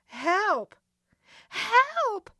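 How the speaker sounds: very high loud screams; scream for help. Acted. Locked in the closet, Nancy screams for help. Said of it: "she screamed".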